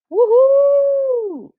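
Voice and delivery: high excited cheer. Real, not acted. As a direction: celebrate the good results loudly. Teacher cheering class results.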